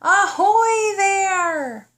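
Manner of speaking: high, loud, very slow pirate hail; drawn-out pirate shout; pirate yell. Acted. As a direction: hail them like a pirate captain. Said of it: "In her best pirate talk, Tickles yelled".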